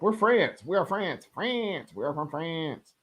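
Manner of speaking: deadpan the "are" like a Conehead; Coneheads impression. Streamer quoting Coneheads to viewers, mock alien voice. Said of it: "Saturday Night Live Coneheads".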